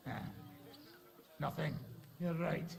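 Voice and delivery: ordinary delivery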